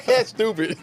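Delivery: high, loud laughter; cracking up; laughing out loud; laughing hard. Real, not acted. Laughing at the quote.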